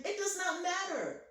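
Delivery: ordinary delivery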